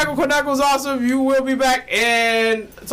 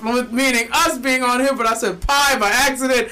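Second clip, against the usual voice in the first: ordinary delivery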